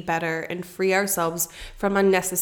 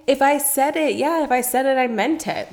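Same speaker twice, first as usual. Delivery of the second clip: higher, louder, defiant self-imitation; mock stubborn insistence; 6 semitones higher, 5 dB louder than usual. Co-host mimicking their old grudge-holding self, stubborn and high-pitched. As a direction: dig in indignantly, pitched up and forceful.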